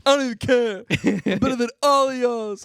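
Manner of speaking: high, loud tearful defiance; mock hysterical crying. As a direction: wail it like a rejected contestant. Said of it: "he's, like, hysterical crying".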